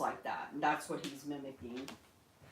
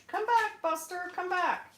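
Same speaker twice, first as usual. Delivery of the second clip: high call to bird; 13 semitones higher than usual. Real, not acted. Calling the bird back.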